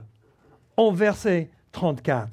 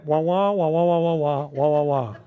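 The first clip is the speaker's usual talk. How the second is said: muffled wah wah talk; 5 semitones lower than usual. Retold or imitated. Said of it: "Donc les enfants".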